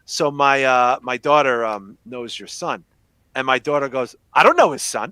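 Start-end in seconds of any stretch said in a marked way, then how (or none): 4.3-5.1 firm, louder mock-earnest child's denial; dead serious. Daughter denying it to her dad, dead serious. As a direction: retell it emphatically, in dead earnest. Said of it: "dead serious".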